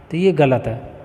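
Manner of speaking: normal talking voice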